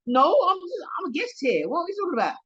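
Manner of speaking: high-pitched, affronted disbelief; indignant protest. Retold or imitated. Guest mistaken for staff, high and indignant. Retells indignant reply to woman's assumption.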